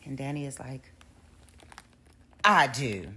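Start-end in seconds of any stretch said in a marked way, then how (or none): 2.4-3.1 louder, firm and defiant; defiant loud objection. Retold or imitated. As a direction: blurt it out to stop the wedding. Danny objects at the wedding.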